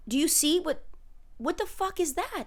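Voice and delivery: ordinary delivery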